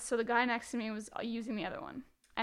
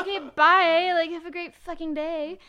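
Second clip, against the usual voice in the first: sarcastic laughing farewell; 5 semitones higher, 8 dB louder than usual. Retold or imitated. Sarcastic farewell to rude guy.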